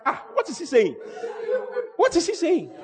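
high, laughing what is he saying; mock confusion. Preacher mimicking a puzzled believer, high and laughing. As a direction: squeak it out in laughing disbelief.

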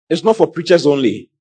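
usual speaking voice

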